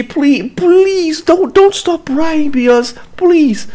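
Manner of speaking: very high, drawn-out begging; mock grovelling. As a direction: wail the plea in hammed-up desperation. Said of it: "Instead, he's like".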